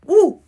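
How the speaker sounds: high whoop after a big hit; breathless woo after smoking; loud woo. Real, not acted. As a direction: let out a sharp high woo. Exhaling after a bong hit.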